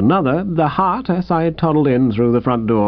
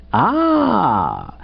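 drawn-out falling ah; 5 semitones higher than usual. Shown as a demonstration. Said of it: "dragged out ... starting high up and going down".

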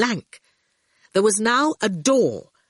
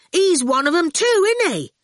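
high, raised, insistent voice; cheeky bargainer; 11 semitones higher, 4 dB louder than usual. A narrator's reading. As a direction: argue the case, pressing questions. Ginger's character voice.

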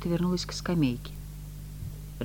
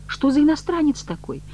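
much higher, raised question; dismayed exclamation; 12 semitones higher, 4 dB louder than usual. A narrator's reading. Woman demanding who the foreigner is, in dismay. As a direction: cry out in confusion. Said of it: "в смятении воскликнула Маргарита так громко".

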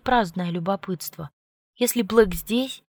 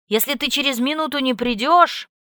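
angry; 6 semitones higher, 5 dB louder than usual. A narrator's reading. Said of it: "не закончил фразу от злости".

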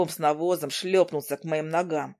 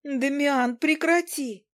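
much higher cry to stop; 10 semitones higher, slower than usual. Acted. Izi crying out to Demian to stop, high-pitched. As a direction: shriek at him to stop. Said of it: "Вскрикнув".

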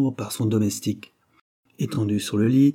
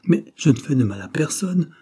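mild irritation, raised; irritated; 5 semitones higher, 4 dB louder than usual. A narrator's reading. Professor denying he has enemies, mildly irritated. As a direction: brush the idea off, a little annoyed. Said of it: "répondit-il avec irritation".

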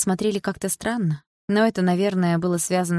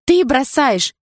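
high, loud exclamation; outraged girlfriend's shout; loud accusation; 9 semitones higher, 6 dB louder, much slower than usual. Acted. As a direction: hurl it back as an accusation. Girlfriend accuses boyfriend of leaving.

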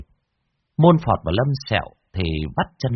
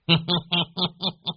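sly chuckle; 8 semitones higher, 3 dB quieter than usual. Acted. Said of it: "Lão Đồng cười phe phé".

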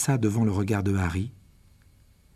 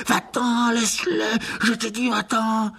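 sudden cry; 11 semitones higher, slower than usual. A narrator's reading. Riddle shouting at the phoenix, furious. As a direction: drive the bird away. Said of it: "s'écria".

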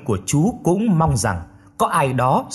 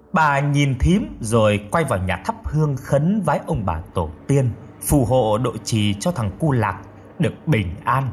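plain reading voice

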